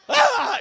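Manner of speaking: sudden high, loud scream; mock scared scream. Pastor joking about a Disneyland scare, mock scream. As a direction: let out a burst of pretend fright. Mimes a haunted house scare.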